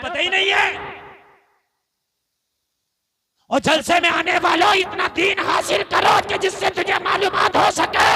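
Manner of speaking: loud, ringing sermon delivery; fiery preacher's call; preaching at full force. Acted. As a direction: thunder it to the gathering.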